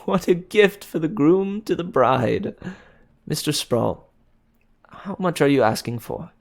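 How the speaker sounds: joking to the shopkeeper; amused. A narrator's reading. Amused fiancé.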